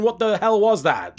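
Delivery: usual speaking voice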